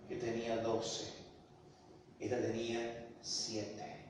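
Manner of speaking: ordinary talk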